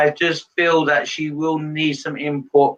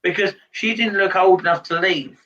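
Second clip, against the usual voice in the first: normal talking voice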